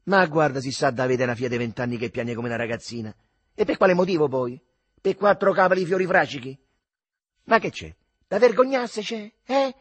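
loud, high challenge; defiant rant. Acted. The mother complains to the guests about her daughter, loud and defiant. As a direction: defy an invisible audience, hands on hips. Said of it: "con un tono più confidenziale".

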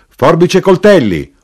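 high, loud knife-grinder's shout; urlo. A narrator's reading. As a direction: yell it out like a street cry. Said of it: "urlò allora l'arrotino". (urlo acuto e forte da arrotino)

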